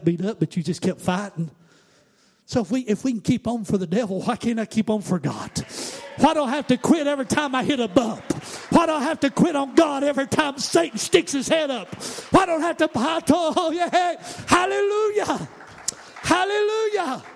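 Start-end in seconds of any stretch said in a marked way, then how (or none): 6.2-17.2 higher and louder, hammering each "you", building to a shout; preaching at full force. Acted. Preacher voicing a believer telling doubters to quit, building to a shout.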